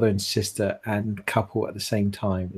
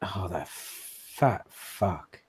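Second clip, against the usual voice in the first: seething, slowly drawn-out insult; exasperated disgust; 8 dB quieter, much slower than usual. Acted. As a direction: stretch each word out in disgust. Character annoyed at absent friend.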